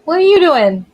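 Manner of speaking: startled exclaim; surprised call. Real, not acted. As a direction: blurt it out at the dog. Sudden exclaim at dog.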